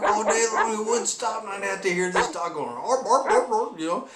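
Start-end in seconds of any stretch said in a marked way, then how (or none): 2.7-3.8 mock barking like the dog; dog bark imitation. Imitating his barking dog for the viewer.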